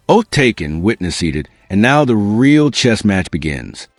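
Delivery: normal talking voice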